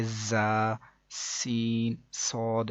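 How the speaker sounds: "زا، سین، صاد" with a long whistling z and s; whistling sibilants. Shown as a demonstration. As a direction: draw out the opening hiss of "زا، سین، صاد". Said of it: "سیٹی کی طرح کی آواز". ("زا، سین، صاد" سیٹی جیسی آواز کے ساتھ)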